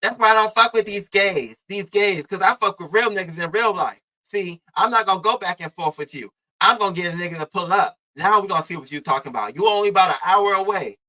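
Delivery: imitating a heated phone tirade; mock angry rant. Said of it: "Reed on the phone like this".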